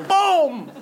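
high, punchy "boom" sound effect; imitated slap. חיקוי קול הסטירה. (חיקוי חבטה של סטירה)

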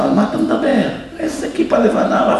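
amused, baffled protest; laughing disbelief. Retold or imitated. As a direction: laugh it off as absurd. Student laughs off accusation.

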